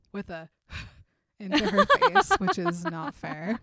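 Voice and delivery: laughing loudly through words; laughing aloud mid-sentence. Real, not acted. Laughing while telling.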